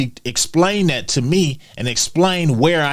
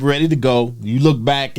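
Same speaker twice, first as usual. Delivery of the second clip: ordinary talk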